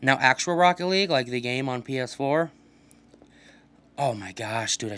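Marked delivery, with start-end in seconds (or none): none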